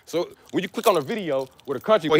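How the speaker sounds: ordinary delivery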